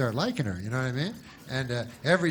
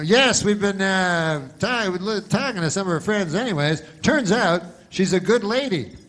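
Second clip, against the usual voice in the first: field reporter; 5 semitones higher, 4 dB louder than usual. Retold or imitated. Reporter answers anchor on air.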